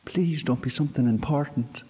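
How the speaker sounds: put-on a high, anxious young girl; girl fretting she's hurt someone; worried girl. A narrator's reading. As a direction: plead under her breath. Girl frets over fallen creature.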